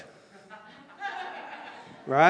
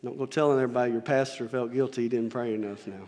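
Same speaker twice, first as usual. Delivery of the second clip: aside; 7 semitones lower, 8 dB quieter, faster than usual. Real, not acted. Aside joke to congregation.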